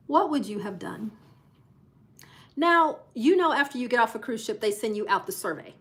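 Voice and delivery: ordinary talk, nothing marked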